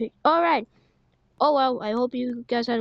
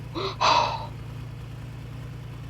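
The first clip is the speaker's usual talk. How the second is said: sharp breathless gasp; high breathless gasp; startled gasp; 8 semitones higher, 7 dB quieter than usual. Real, not acted. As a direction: gasp in shock. Tornado approaches.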